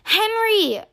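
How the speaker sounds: high loud call of a name; calling loudly. A narrator's reading. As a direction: call him from another room. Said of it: "Mama calls loudly".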